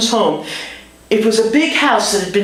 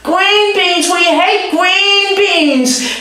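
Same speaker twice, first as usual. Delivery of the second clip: much higher and louder, slow, whining protest; kids yelling in protest; 12 semitones higher, 3 dB louder, much slower than usual. Retold or imitated. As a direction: howl it like kids who hate vegetables. Kids protesting green beans.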